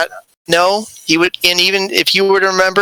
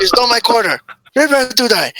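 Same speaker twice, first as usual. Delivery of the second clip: put-on Inigo Montoya accent; 6 semitones higher than usual. Inigo Montoya-style Spanish accent, threatening the bully. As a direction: declaim a duel challenge, Spanish swordsman style. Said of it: "The Inigo Montoya accent".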